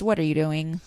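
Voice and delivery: ordinary delivery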